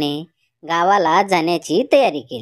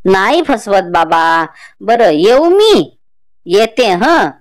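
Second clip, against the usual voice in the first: put-on louder, slow old woman's voice; old grandmother; 8 dB louder, slower than usual. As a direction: speak as the village grandmother, slow and loud. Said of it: "म्हातारी म्हणाली".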